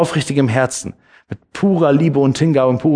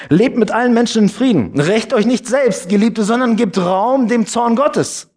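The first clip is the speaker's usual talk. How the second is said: raised, higher solemn reading of the Bible verse; scripture reading; 8 semitones higher than usual. Acted. Pastor to the congregation, reading Römer with raised solemn voice. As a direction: read it out to the congregation like proclaiming scripture.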